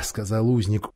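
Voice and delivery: usual speaking voice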